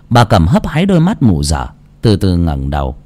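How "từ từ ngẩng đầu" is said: plain reading voice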